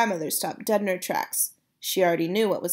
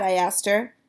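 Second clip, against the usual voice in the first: plain reading voice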